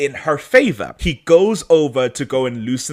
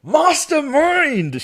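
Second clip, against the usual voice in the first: high, drawn-out cry of admiration; gleeful exclamation; 3 dB louder, much slower than usual. Real, not acted. Host cheering the heroine's clever escape, gleefully exclaiming. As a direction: stretch it out high, relishing the triumph.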